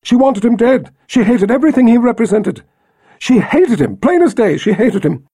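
rising, fast, frantic protest; nervous babbling. Acted. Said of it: "visibly forcing herself to stop babbling".

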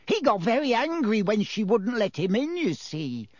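high, delighted, relishing the secret; gleeful poltergeist. A narrator's reading. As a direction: savour the bombshell you're about to drop. Peeves voice held.

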